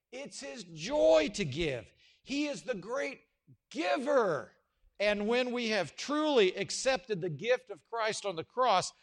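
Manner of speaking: slow, loud, rising proclamation; preaching at full force. Acted. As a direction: build it like a pulpit climax. Preacher builds to emphasis.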